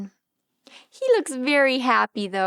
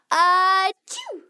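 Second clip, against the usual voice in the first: big pretend sneeze; 4 semitones higher, 4 dB louder than usual. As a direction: fake a loud sneeze. Acting out sneeze in book.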